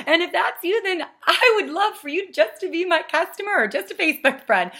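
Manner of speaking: high, loud, jokey gush; exaggerated peppy sweetness played for laughs; making light of it. Shown as a demonstration. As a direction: tease them at full pitch. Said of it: "I make light of it".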